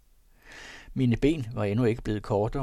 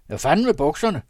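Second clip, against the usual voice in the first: higher and louder gruff yell; angry shout; 6 semitones higher, 8 dB louder, faster than usual. A narrator's reading. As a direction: bellow the question at the young man. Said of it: "råbte hr. Lamstrup". (et højt, højere råb)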